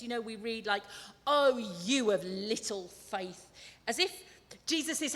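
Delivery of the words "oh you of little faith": low, slow, stern reproach; cross inflection. Shown as a demonstration. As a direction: draw it out as a cross telling-off. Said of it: "we put that sort of cross inflection on these words".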